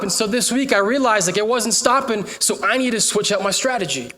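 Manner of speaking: usual speaking voice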